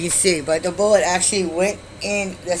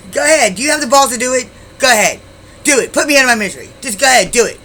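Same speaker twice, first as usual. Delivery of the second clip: loud, high, forceful goading; defiant dare; 7 semitones higher, 8 dB louder, faster than usual. Retold or imitated. Woman with knife at throat to boyfriend, defiant shouting. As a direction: re-enact daring him in raised bursts.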